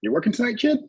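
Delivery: high-pitched mimicked old-timer question; imitated wrestling veteran. Veteran wrestler questions newcomer in locker room, imitated in high voice. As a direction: put on a high voice, sizing up the kid. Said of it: "Abdul the Butcher looks at, looks at me and he was like".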